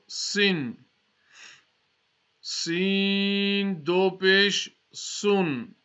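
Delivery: slow, clear recitation with long pauses for students to repeat; slow spelling drill. Shown as a demonstration. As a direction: spell each syllable out slowly so the class can follow. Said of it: "try to read it slowly".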